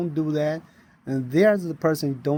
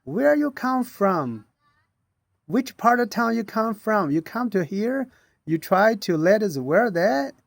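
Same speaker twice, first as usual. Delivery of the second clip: retold yelling; 7 semitones higher than usual. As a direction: imitate the subway worker scolding him, pitched up high. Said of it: "yelling at me".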